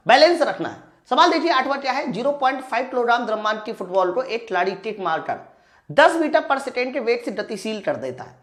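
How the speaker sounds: ordinary delivery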